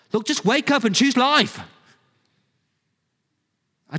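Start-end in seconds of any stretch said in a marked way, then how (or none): 0.1-1.6 loud, high-pitched impatient shout; exasperated urging. Shown as a demonstration. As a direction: shout it like a wake-up call. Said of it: "Or is it kind of a".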